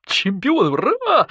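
high, howling cheer; jubilant howl. A narrator's reading. Wolf joins the badger celebrating the win, howling jubilantly. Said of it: "взвыл и запрыгал волк".

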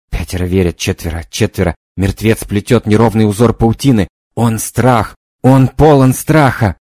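plain reading voice

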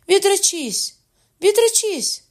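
very high, loud desperate cry; mother's scream. A narrator's reading. Mother begging her son to renounce, screaming. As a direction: shriek the command twice, falling at the end. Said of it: "кричала мати".

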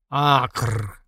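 drawn-out guttural monster croak; mutant growl. A narrator's reading. Mutant blurting at armed men, guttural growl. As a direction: let the sound tear out of a mutant's throat. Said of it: "вырвалось из глотки мутанта".

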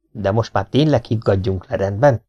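usual speaking voice

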